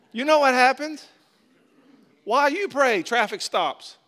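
high, loud and excited; loud preaching. Real, not acted. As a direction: belt it to the congregation. Preaching at full force.

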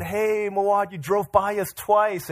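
exasperated complaint. Retold or imitated. Passenger phoning the driver who passed them, mild exasperation. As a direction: retell a mildly exasperated phone call.

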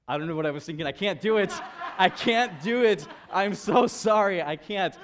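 high, very loud, hammed-up pleading; mock panicked refusal. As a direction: imitate a wife backing out in loud panic. Wife refusing ride second time.

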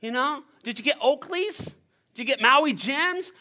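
high-pitched excited questioning; curious friends. Acted. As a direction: play an eager friend fishing for details. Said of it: "They're like".

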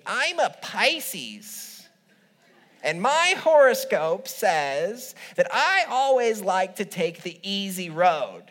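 slow, high nasal drawl. Retold or imitated. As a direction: imitate her nasally voice. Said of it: "with her nasally voice".

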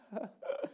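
short breathy demo laugh; laughing on demand. Shown as a demonstration. Showing the congregation that laughter is contagious, laughing. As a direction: start laughing for no reason. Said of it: "I could start laughing right now".

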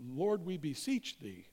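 a hint of Downton Abbey pomp; mock period-drama prayer. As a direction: gently send up a stately, old-fashioned prayer. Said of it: "sounding like somebody out of the cast of Downton".

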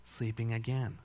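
usual speaking voice

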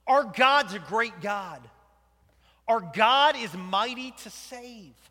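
loud, slow pulpit proclamation; preaching. Real, not acted. Preacher proclaiming God's might, loud and slow. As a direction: proclaim it to the congregation.

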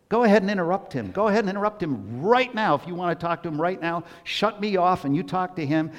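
energetic urging. Acted. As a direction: spur them on to interrupt. Urging congregation.

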